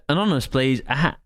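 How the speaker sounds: ordinary talk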